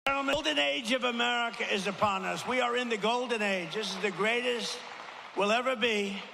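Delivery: high, sweeping closing oration building to shouts; rally finale. Real, not acted. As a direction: lift the crowd with a grand finish.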